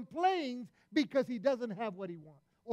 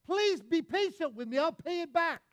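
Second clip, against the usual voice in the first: louder, higher pleading plea; raised, pleading servant's cry; begging servant; 7 semitones higher, 6 dB louder than usual. Retold or imitated. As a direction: beg the king for time, voice raised high. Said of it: "he begs the king".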